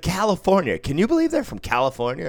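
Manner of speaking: loud, high retold outburst; mock incredulity. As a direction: exclaim it in high-pitched disbelief.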